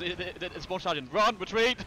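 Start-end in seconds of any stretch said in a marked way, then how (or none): 1.1-1.8 higher, louder yell to fall back; shouted order. Real, not acted. Player yells for the squad to retreat, loud and urgent.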